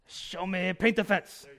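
loud, high, hammed-up Japanese accent; Miyagi accent. Preacher redoing his Miyagi impression for laughs, loud exaggerated Japanese accent. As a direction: belt out a mock Japanese-accented sensei command. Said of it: "that's the best accent I can do".

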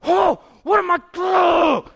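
loud, high panicked yelps; hammed-up panic, crying out; freaking out. Man freaking out at the moment of confrontation, panicked yelling. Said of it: "I'm freaking out".

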